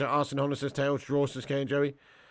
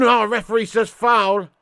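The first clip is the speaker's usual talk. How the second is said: loud disbelief; 7 semitones higher, 11 dB louder than usual. Real, not acted. Reacting to a foul call.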